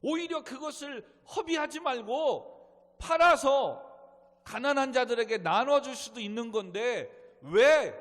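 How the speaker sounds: loud, high indignant reproach; acted rebuke. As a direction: scold her forcefully, as the indignant disciples. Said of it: "그 여자를 책망하였더라".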